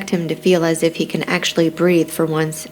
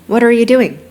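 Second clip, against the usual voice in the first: louder, higher-pitched question; raised-voice questioning from a mother; mother's demand; 7 semitones higher, 7 dB louder than usual. A narrator's reading. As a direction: pitch it up and project. Mother confronts son in barn.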